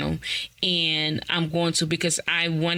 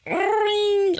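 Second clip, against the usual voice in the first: high sung ring-ring; phone ring; 3 dB louder than usual. Retold or imitated. Calling her psychologist sister, imitated ringtone. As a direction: mimic a phone ringing.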